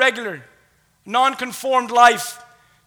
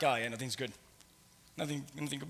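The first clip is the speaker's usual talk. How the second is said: very low, soft, dejected aside; downbeat mumbled aside; 9 semitones lower, 16 dB quieter than usual. Acted. Preacher voices what follows the prayer, downbeat. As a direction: let it drop away, defeated.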